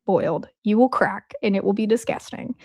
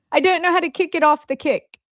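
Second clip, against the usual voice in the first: higher-pitched, badly done British accent; crappy British accent; 5 semitones higher, faster than usual. Real, not acted. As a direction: slip into a clumsy British accent. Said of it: "I had a British accent when I did that, a, a crappy one".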